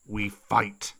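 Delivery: low, growly wolf answer; deep wolf pack bark. Acted. Wolf pack answers Mowgli's call to fight, deep bark. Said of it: "a chorus of deep crashing barks".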